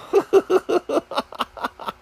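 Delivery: high-pitched laughing fit. Real, not acted. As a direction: break into high, helpless laughter. Laughing at his own story.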